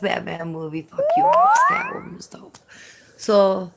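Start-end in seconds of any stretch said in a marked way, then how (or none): 0.8-1.6 mock yell. Playful jab at Alex. 1.7-2.6 whisper. Acted. Aside after Batman rant.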